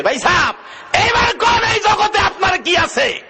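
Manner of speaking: high shouted question, falling at the end; loud challenge. Retold or imitated. Cleric confronting a weeping brother, high loud shout.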